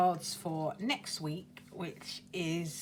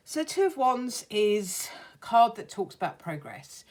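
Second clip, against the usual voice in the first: ordinary delivery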